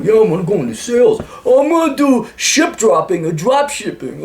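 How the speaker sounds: high, exaggerated bro voice; mock dude bro. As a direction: swagger through it like a clueless bro. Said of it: "Not that you guys sound like that".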